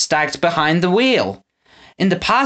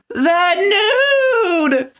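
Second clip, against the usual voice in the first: shocked scream. A narrator's reading. A townsperson seeing the trolls, shocked scream. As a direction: shriek in horror. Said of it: "screamed someone".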